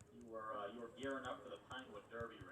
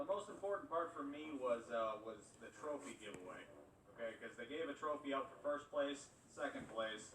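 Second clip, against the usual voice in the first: ordinary talk, nothing marked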